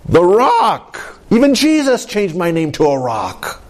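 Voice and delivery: lightly hammed-up bragging; a hint of a self-important disciple; boastful Peter. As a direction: announce your title with swagger. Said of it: "I mean, he's like".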